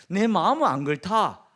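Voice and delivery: loud, emphatic Gyeongsang protest; Gyeongsang dialect. Acted. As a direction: insist loudly with swinging Gyeongsang intonation. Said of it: "우리 경상도 사람들이 하는 말이". (경상도 억양으로 크게 항변하듯)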